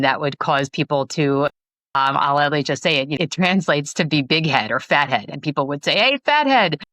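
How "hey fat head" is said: mocking taunt. Kids taunting her about her name, mocking jeer. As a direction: sneer it like a teasing kid.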